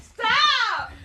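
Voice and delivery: high, loud yelp to stop; laughing shout. Real, not acted. Telling her partner off while laughing, loud shout. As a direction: yell it through laughter.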